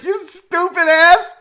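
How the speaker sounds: high, slow mock jeer; mocking laughing friend. Host voicing the laughing guy in the clip, mocking jeer. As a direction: jeer like the pal who is cracking up. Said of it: "Look at the guy laughing".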